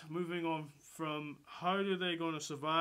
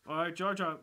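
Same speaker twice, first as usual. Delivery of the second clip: normal talking voice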